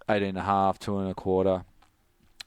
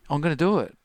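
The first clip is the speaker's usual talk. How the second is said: defiant insistence; 6 semitones higher, 4 dB louder, faster than usual. Retold or imitated. Insisting to captain he'll jump.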